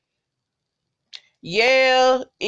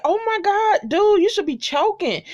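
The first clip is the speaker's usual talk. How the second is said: mock amazement; 12 semitones higher than usual. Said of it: "And I'm like".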